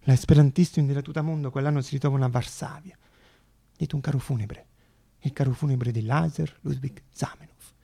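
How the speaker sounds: usual speaking voice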